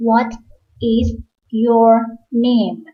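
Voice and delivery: "What" "is" "your" "name" said slowly, one by one; separate words. Shown as a demonstration. Teacher reading each word apart to beginners. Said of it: "What กับ is เชื่อมกัน".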